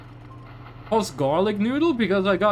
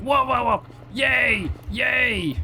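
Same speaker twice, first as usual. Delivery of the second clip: imitated dog cheering, higher pitched; higher, excited dog voice; happy dog; 4 semitones higher, slower than usual. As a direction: bark out the words like a delighted dog. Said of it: "And the dog's like".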